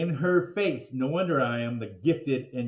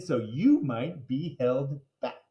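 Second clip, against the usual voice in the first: ordinary delivery, nothing marked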